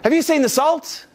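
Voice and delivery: high, carrying call to another room; a mock call from the kitchen to the partner upstairs. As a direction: yell it through the house to your partner. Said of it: "you yell out to your partner".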